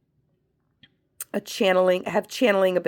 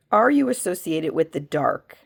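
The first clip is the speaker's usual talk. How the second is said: normal talking voice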